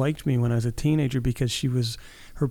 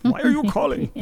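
high, louder mock of a curt boss; mock yelling boss; 12 semitones higher, 3 dB louder, faster than usual. Mocking how the boss answered the phone, high and curt. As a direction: snap it like a rude receptionist. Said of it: "she was yelling at me".

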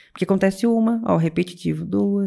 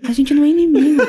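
giggling mock of the husband, higher and louder; laughing imitation; 6 semitones higher, 5 dB louder than usual. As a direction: laugh through his serious line. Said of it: "aquele homem".

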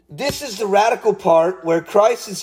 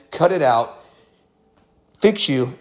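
ordinary delivery

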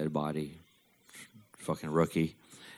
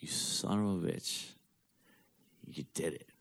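hushed awe; 5 dB quieter than usual. Retold or imitated. Son to his dead father on finding the powder, hushed amazement.

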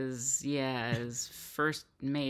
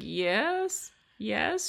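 high, stilted attempt at an American accent; put-on American accent, pitched up; forced American accent; 6 semitones higher than usual. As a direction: imitate someone trying hard to sound American. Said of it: "trying to sound American".

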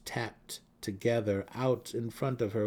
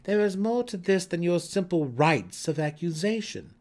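higher, louder cold rebuke; stern rebuke; 9 semitones higher, 7 dB louder than usual. Acted. Senefe rebuking Malice before the council, loud and high. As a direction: put her in her place.

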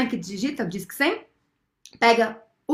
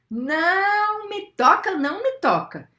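high, drawn-out "não"; emphatic refusal; 7 semitones higher than usual. Acted. Storyteller teaching children to refuse, emphatic refusal. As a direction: stretch the first word, then repeat it firmly.

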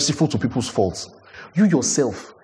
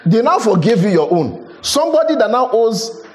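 impassioned preaching; 6 semitones higher than usual. Acted. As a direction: drive the debt image home with fire. Preaching at full force.

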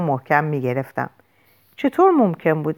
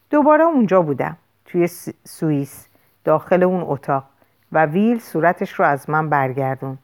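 plain reading voice

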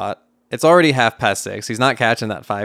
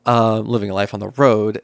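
ordinary delivery

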